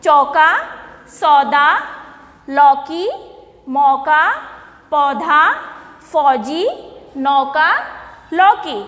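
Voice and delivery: each word said apart, "कौवा" "लौकी" with a clear open औ; word list read out. Shown as a demonstration. Teacher reading the word list aloud to children, clear and separate. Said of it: "सारे शब्दों को एक बार फिर से देखिए".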